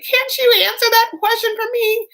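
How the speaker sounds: high, loud mock wail; hammed-up heartbroken pleading; devastated. Wife begging Michael for an answer, mock devastated wail. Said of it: "And she's just devastated".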